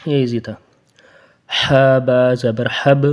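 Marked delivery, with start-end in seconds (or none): 1.5-3.1 slow, with a bounced ب in حب; slow teaching drill; qalqala on ba. Shown as a demonstration. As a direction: give حب a little echoing jolt. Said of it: "با کے اوپر قلقلہ کرنا ہے".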